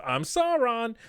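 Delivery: high-pitched hammed-up self-introduction; mock reveal announcement. Critic parodying Sauron telling the camera who he is, high mock announcement.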